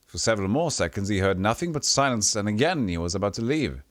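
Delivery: ordinary talk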